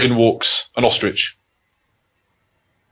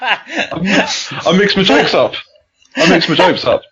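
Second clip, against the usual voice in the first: higher-pitched, laughing self-mockery; laughing admission; 6 semitones higher, slower than usual. Real, not acted. Comic admits botching his joke to his co-host, laughing. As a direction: own up through real giggles.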